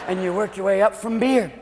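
normal talking voice

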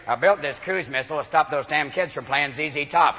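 low-voiced man proud of his missile; lightly voiced low, grumbling homeowner; gun-nut neighbour. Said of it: "Pretty soon it's gonna be".

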